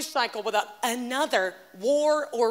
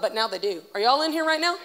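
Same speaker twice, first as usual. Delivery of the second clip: usual speaking voice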